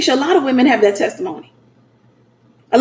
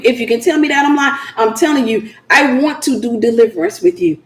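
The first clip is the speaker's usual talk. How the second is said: ordinary delivery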